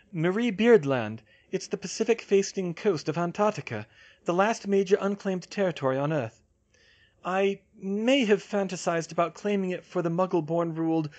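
high-voiced know-it-all heroine; Hermione, explaining.

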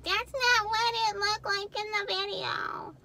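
high, slow whiny complaint; sing-song mock customer complaint; mock annoyed viewer. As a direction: play a disappointed buyer griping. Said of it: "and you're like".

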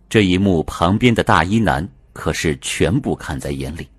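usual speaking voice

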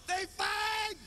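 announcement shout. Retold or imitated. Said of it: "Made an announcement".

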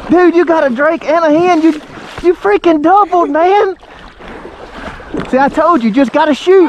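high-pitched, proud celebration; thrilled. Real, not acted. Father celebrates child's double.

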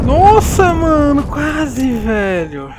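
loud, high excited yelling; excited shout. Real, not acted. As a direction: yell it out mid clutch. Clutch round nearly won.

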